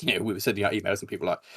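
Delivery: ordinary delivery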